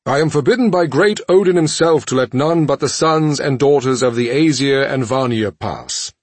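booming formal sentry voice; guard voice. Acted. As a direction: proclaim like a stern gatekeeper. Asgardian guard refuses entry.

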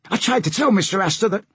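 high-voiced deckhand making excuses; apologetic sailor. Sailor excuses himself to the captain, high voice. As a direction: explain yourself in a high sailor voice.

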